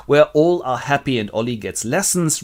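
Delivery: plain reading voice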